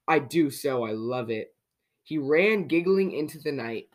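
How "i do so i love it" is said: plain reading voice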